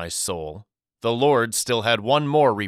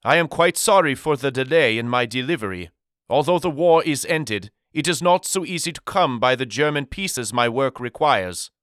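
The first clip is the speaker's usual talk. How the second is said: put-on quick, higher apology in a heavy European accent; thick European accent; 4 semitones higher than usual. The German deliveryman apologizes to Daddy in thick European accent. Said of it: "he inquired with a thick European accent".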